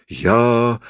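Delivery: ordinary delivery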